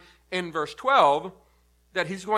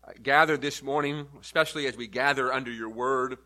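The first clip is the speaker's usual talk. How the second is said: lower, softer prayerful voice; a pastor's hushed opening prayer; 6 semitones lower, 5 dB quieter than usual. Acted. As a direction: pray it quietly and reverently. Opening prayer before the sermon.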